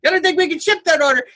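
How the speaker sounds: high, fast phone-call chatter; businessman on a call. Retold or imitated. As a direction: imitate a businessman rattling into his headset. Acting out man on Bluetooth call.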